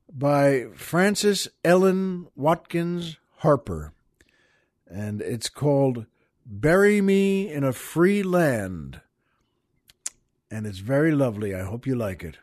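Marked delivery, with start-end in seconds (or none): none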